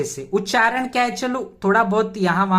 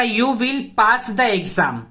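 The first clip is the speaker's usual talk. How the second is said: slow teaching read; much slower than usual. Shown as a demonstration. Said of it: "ठीक है, क्या हो जाएगा?".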